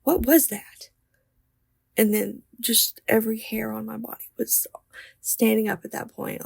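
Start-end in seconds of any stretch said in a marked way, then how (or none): 0.0-0.9 startled husband. Retold or imitated.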